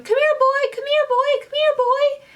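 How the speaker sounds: high coaxing call to a dog; mock-sweet voice calling the dog over; imitating the lady. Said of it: "you see the lady going".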